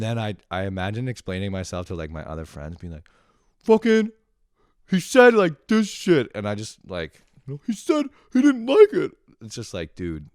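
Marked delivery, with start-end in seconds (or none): 3.6-6.3 high, loud hammed-up outrage; mad ranting. Wronged cousin venting to his other friends, hammed-up mad rant. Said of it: "I got so fucking mad". 7.7-9.1 shrieky, over-the-top mock tantrum; mad outburst. Venting to friends about his cousin, hammed-up mad outburst. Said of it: "I got so fucking mad".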